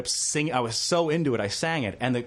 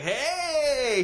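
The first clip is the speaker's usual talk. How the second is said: cheerful greeting; 11 semitones higher than usual. Retold or imitated. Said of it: "he's like".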